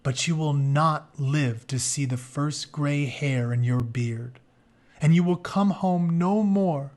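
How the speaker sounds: ordinary delivery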